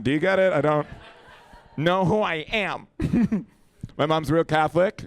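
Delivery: ordinary talk, nothing marked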